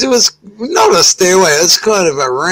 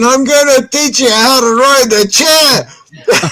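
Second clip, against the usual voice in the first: higher-pitched, proclaiming voice; son's showman announcement; 5 semitones higher than usual. Retold or imitated. Son atop the hill with a chair, showman announcement. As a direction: announce a stunt to the crowd.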